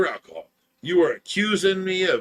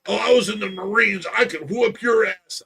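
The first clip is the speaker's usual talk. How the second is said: higher, louder mock boast; mocking tough-guy brag; 5 semitones higher, 5 dB louder than usual. Mimicking the friend boasting about being a Marine. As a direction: ham up a skinny guy's bragging.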